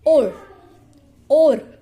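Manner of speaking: loud teacher demo of और; r-controlled or sound. Shown as a demonstration. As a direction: sound out और clearly for the kids. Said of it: "मैंने हिंदी में specially लिख रखा है ताकि आप उसको उसमें प्रोनाउंस".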